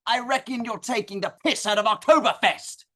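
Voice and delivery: put-on light Australian accent, picking a fight; a hint of a surly Aussie bloke. Aussie drinkers confront the brothers.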